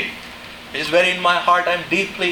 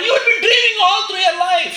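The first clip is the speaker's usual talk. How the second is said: preaching shout; 12 semitones higher than usual. Real, not acted.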